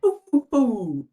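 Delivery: spooky granny hoo hoo; ghostly granny hoot. Acted. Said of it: "Can you give me a hoo hoo hoo?".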